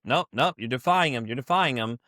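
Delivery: ordinary talk, nothing marked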